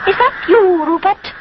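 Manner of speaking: high, bird-like little cuckoo. A narrator's reading. Wooden cuckoo calling to Rupert, birdlike. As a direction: call out like a small bird.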